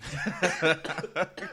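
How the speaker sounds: laughter bursting out; loud laugh. Real, not acted. Laughs at his own line.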